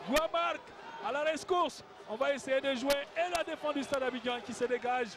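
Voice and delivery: excited high-pitched play-by-play; rising, fast live call; esports caster. Real, not acted. Goal chance in live commentary.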